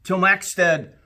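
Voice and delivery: put-on lively read-aloud for children, rising with the adventure; storybook narration. A narrator's reading. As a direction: tell it like a bedtime story to a class. Reads picture book aloud.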